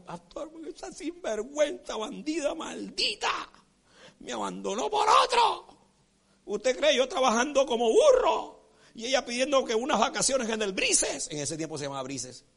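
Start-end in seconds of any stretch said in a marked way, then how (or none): 0.0-5.7 high-pitched crying complaint, rising to a wail; mock tearful wailing. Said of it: "Y el hombre llorando, llorando". 6.5-11.3 higher-pitched, whining indignant lament; mock tearful complaint. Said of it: "el hombre llorando".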